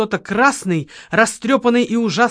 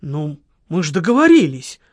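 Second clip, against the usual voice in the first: lower, hesitant, sheepish voice; embarrassed mumbling; 4 semitones lower than usual. A narrator's reading. Ukho explains his lateness to Lola, sheepishly mumbling. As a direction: excuse yourself awkwardly in a low register. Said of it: "смущённо бормотал Ухо".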